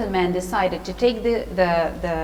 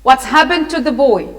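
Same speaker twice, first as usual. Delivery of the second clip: loud, demanding question; raised storybook voice; shouting; 2 semitones higher, 7 dB louder than usual. A narrator's reading. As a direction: shout it as an old villain demanding answers. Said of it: "the old man shouted".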